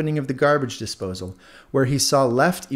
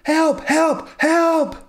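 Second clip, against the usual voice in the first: much higher, loud yelps for help; panicked cries; 6 dB louder, much slower than usual. Acted. Earbud shrieks for help as its twin falls, panicked. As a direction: cry out in alarm. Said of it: "exclaimed one of the earbuds".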